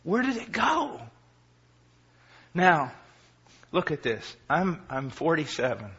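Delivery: ordinary delivery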